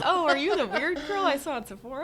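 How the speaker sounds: light laughing voice of the shopper; laughing imitation. Owner imagining the shopper recognising her, laughing. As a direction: tease by playing the shopper.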